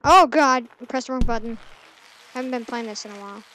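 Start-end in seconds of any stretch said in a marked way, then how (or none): 0.1-0.7 loud startled oh God; real alarm. Real, not acted. Pressed wrong button.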